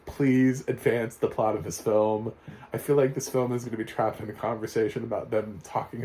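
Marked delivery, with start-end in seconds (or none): 0.0-2.3 drawn-out, louder exasperated plea. Retold or imitated. Reviewer begging the film to advance its plot, drawn-out exasperated plea. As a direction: beg the film to move on, slowly. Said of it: "And I was like".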